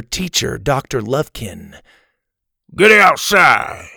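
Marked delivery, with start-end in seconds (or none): 2.7-3.9 slow, loud, gruff bellow; gruff shout. Acted. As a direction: bark it out like a mouthful of marbles. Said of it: "the man shouted".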